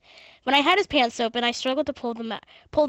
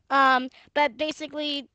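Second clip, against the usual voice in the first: ordinary talk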